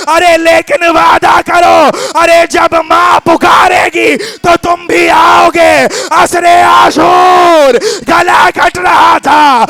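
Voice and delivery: rising, wailing majlis lament, slowed on the cries; preaching at full force. Acted. Reciter wailing Zahra's call to Husain at full force.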